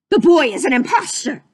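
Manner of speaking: loud gruff shout; Pete shouting. Acted. Captain Pete shouts at the court, loud. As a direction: bellow the accusation. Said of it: "shouted Pete".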